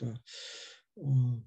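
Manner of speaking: ordinary talk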